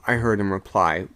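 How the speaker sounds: ordinary delivery, nothing marked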